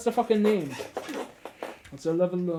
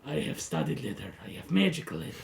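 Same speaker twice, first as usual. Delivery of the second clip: lizard folk voice. Acted. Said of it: "wait, lizard folk voice".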